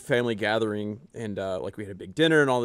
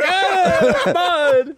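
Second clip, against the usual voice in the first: high, loud gleeful shriek with a laugh; delighted squeal; 9 dB louder than usual. Retold or imitated. Kid in the mud, squealing with glee.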